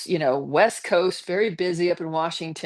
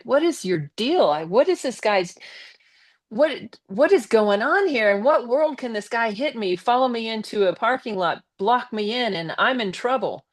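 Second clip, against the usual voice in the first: indignant protest; 5 semitones higher than usual. Retold or imitated. Handcuffed, protesting to police.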